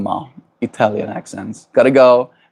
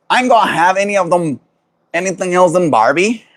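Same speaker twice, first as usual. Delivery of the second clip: high-pitched mock Aussie voice; hammed-up Australian impression; Australian accent; 7 semitones higher than usual. Streamer poking fun at Australians to the chat, Australian accent. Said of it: "I can't do an Australian accent".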